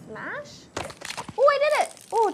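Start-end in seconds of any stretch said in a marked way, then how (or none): 1.4-2.3 high, loud burst of triumph; excited cry. Real, not acted. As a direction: yelp with real delight. Finally opens the coconut.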